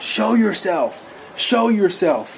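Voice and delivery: drawn-out, high call, only half a scream; retold scream. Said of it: "I started screaming out loud".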